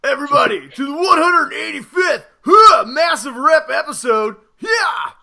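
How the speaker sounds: loud, pumped-up meathead host; gym bro hype. Acted. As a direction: shout the welcome like a bodybuilder. Gym bro persona welcome.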